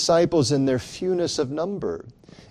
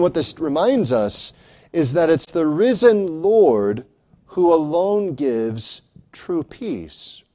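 ordinary talk, nothing marked

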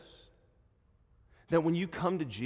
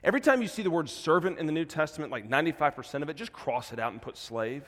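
ordinary delivery

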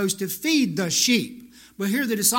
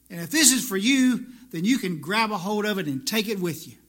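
ordinary talk

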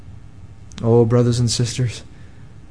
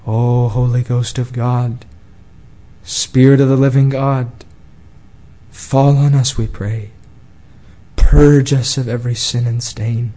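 solemn prayer; 3 semitones lower than usual. Acted. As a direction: pray humbly for the church. Closing prayer.

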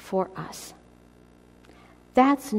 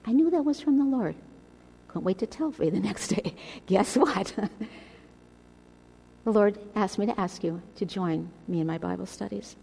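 ordinary talk

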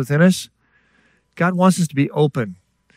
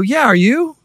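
bright retold reply; 9 semitones higher than usual. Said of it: "And I go".

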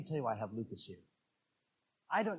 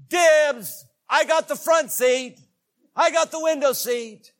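put-on loud, high kid's yell; competitive boy calling dibs; 12 semitones higher, 15 dB louder, slower than usual. Preacher imitating a boy racing for the front seat, loud high yell. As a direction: shout it out to claim it first. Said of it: "say things like".

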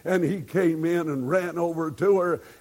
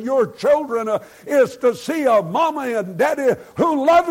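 rising, louder preaching push; fervent preaching; 7 semitones higher, 7 dB louder than usual. Acted. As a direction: exhort the congregation with full force. Preacher exhorts the congregation.

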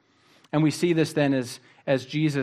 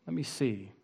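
much softer repeat of the plea; softer echo; 7 dB quieter than usual. Acted. Preacher echoes the blind man's plea, hushed. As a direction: let it fall away, almost to yourself.

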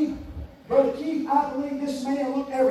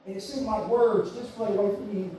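usual speaking voice

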